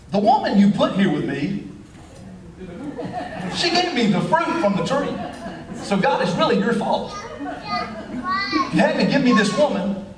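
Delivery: lightly voiced Adam blaming God; whiny Adam. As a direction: pass the blame in a mock whine.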